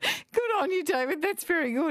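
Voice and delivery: high and laughing through the words. Real, not acted. Host congratulating an eighty-year-old caller, still laughing. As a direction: praise him while still laughing.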